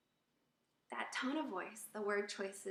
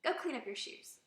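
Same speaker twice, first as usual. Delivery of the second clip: higher, sharp command; curt, angry order; 5 semitones higher, 3 dB louder than usual. Shown as a demonstration. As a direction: order a child with an edge. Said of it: "see what a difference that is".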